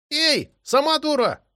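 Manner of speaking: high, slow yell through a mouthful; yelling with mouth full. A narrator's reading. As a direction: shout back past a mouthful of spaghetti. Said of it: "заорал Даниэля с набитым ртом".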